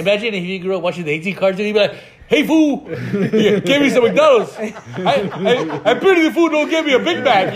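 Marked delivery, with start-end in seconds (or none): 2.3-7.5 loud, high, laughing tough-guy impression; hammed-up TV action hero bellow; Mister T. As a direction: bark it out like a cartoon tough guy. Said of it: "not from fucking Mister T".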